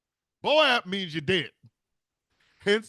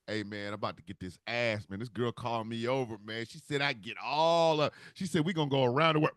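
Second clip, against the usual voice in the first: victim bragging; 6 semitones lower than usual. Retold or imitated. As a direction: play the doomed guy showing off. Voicing a victim before death.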